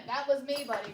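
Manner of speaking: usual speaking voice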